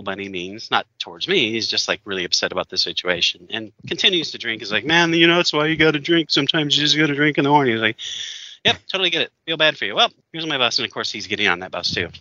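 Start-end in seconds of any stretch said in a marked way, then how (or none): 4.8-7.7 put-on high, loud, rushed drunk rambling; drunk guy voice. Imitating the drunk man at the bus stop, high and loud. 8.6-10.8 higher, breezy fake sympathy; polite brush-off. Telling the drunk man he gets it, lightly mocking. Speaker voicing own brush-off.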